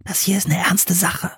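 tonlos und hoch geflüstert; Flüstern. A narrator's reading. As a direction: ernst und ganz leise zischen, damit niemand es hört. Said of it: "flüsterte er". (Philipp flüstert Anne im dunklen Wald ernst zu)

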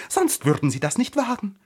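high, loud, worked-up flower; indignant, high-voiced outburst; agitated panting. A narrator's reading. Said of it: "keuchte sie".

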